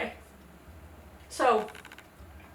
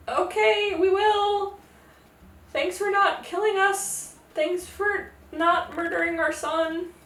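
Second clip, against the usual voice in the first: nervous thanks; 7 semitones higher, slower than usual. Retold or imitated. Scared parents thank the goddess.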